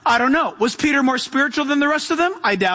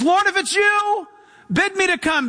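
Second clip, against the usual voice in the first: much higher, pleading call; Peter's urgent plea; 6 semitones higher than usual. Retold or imitated. Peter begging Jesus from the boat, high urgent plea. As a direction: reach out to him with a desperate hope. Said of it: "and he says".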